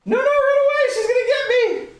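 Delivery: high, loud playful scream; mock panic. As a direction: shriek as if being chased.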